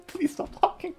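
high, soft laughing repeat; high giggling mock plea. Real, not acted. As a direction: beg through laughter.

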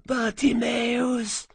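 high, distant ghostly call of a name; high faraway echoing call; faint echo calling. A narrator's reading. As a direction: call out like an echo from far away. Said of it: "das Echo eines Echos".

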